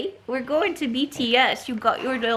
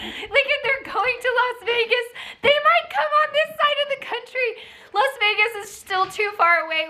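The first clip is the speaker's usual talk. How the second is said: high-pitched giddy gushing; freaking out; 8 semitones higher than usual. Real, not acted. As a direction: gush breaking news with laughs slipping in. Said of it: "What am I freaking out about?".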